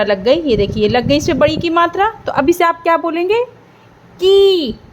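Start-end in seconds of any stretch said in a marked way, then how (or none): 4.2-4.8 "की" with the ee held long; teacher's long, clear "की"; long ee sound. Shown as a demonstration. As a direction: draw out the vowel in "की". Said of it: "थोड़ी बड़ी सी आएगी".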